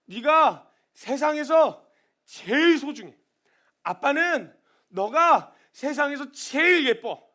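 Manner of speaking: slow, loud heartfelt proclamation; tender declaration. Acted. God telling his children they are precious, slow and fervent. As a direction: proclaim love slowly from the heart.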